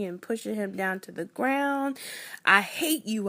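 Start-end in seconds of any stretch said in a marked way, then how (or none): 2.4-3.3 louder retold scream. A six-year-old screaming at the boy who mocked her. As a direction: shout it out in a rage. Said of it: "I screamed".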